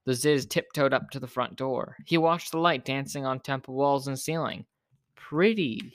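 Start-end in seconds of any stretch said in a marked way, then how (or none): 5.1-5.9 high, admiring coo; dreamy higher-pitched wonder. A narrator's reading. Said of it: "he cooed".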